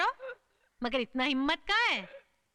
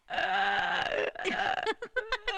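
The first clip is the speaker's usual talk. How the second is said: startled cry; 5 semitones higher than usual. Acted. Woman startled by crying boy.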